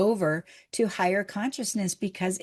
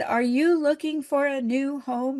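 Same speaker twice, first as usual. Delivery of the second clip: retold yell; 6 semitones higher than usual. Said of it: "yells over to us".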